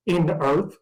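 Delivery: ordinary talk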